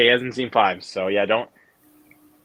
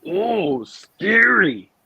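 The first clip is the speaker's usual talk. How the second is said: higher, louder mocking villain sneer; Freddy Krueger taunt; 8 semitones higher, 5 dB louder, much slower than usual. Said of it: "in the words of Freddy from Freddy versus Jason".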